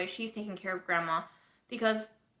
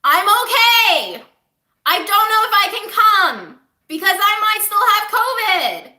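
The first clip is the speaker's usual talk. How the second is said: screaming down the phone; 11 semitones higher, 11 dB louder than usual. Retold or imitated. Granddaughter yelling to grandma on a bad phone line. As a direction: shout so a deaf grandma hears. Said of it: "I had to scream to Grandma".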